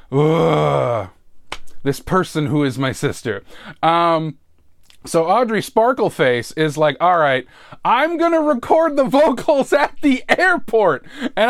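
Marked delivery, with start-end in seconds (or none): 0.1-1.1 long, drawn-out "ugh"; exasperated groan. Real, not acted. Exasperated at sister in story. 7.0-11.0 high-pitched mock announcement of a plan; imitating sister. Voices sister's absurd plan.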